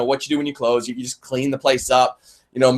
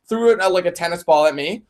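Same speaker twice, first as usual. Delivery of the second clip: normal talking voice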